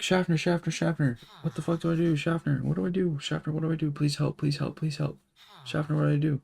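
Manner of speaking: high, frantic mock panic; whiny high pleading imitation; panicked pleading. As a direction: plead for help like a scared recruit. Panicked recruit voiced in story.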